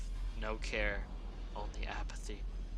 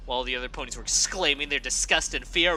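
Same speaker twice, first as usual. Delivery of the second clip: usual speaking voice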